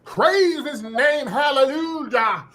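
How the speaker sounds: very high, slow, belted hallelujah preaching; mock Southern preacher. Mocking her put-on cadence, high drawn-out mock preacher. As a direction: wail it like an overblown Baptist sermon. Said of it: "Doing her mouth all wide".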